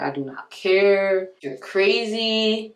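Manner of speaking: slow, drawn-out dismissal; dismissive. Retold or imitated. Seller to the crying buyer, slow and dismissive. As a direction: stretch each phrase out, unbothered by the tears.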